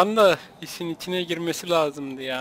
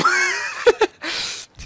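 high, breathless laughing fit; 10 semitones higher than usual. Real, not acted. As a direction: laugh helplessly, high-pitched. Kendi lafına gülüyor. (tiz gülme krizi)

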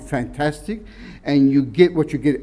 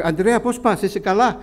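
put-on older Greek parishioner; 6 semitones higher than usual. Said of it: "some of the older people".

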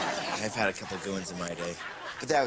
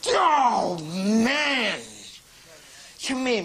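frustrated yell; 12 semitones higher, 6 dB louder, much slower than usual. Acted. Loses count, frustrated.

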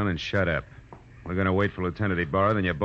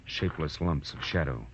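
noir narration; 3 semitones lower than usual. A narrator's reading. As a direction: spin the tale like a hard-boiled private eye. Detective narration over music.

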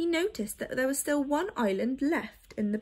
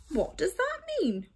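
puzzled, much higher question; wondering; 8 semitones higher than usual. A narrator's reading. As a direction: ask it truly baffled. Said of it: "wondered Oliver".

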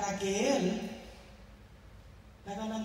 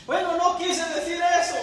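pleading husband; 10 semitones higher, 9 dB louder than usual. Retold or imitated. Husband defends himself in quarrel.

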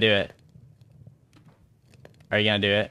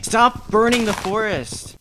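exasperated shout; 10 semitones higher, 4 dB louder, slower than usual. Real, not acted. Yelling at Herobrine.

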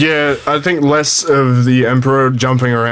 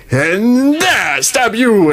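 Emperor voice; 8 semitones higher, much slower than usual. Retold or imitated. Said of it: "He just goes".

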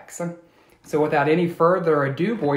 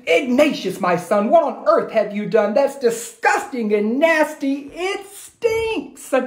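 high, scolding mum voice; horrified, shrill-pitched mother scolding; disgusted mother; 9 semitones higher than usual. Acted. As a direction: squeal at the smelly mess. Mother scolds about dirty diapers.

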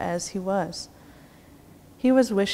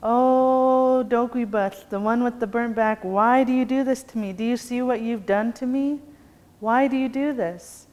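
scared outcry; 5 semitones higher than usual. A narrator's reading. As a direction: cry out in panic, tangled and trapped. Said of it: "he became scared again".

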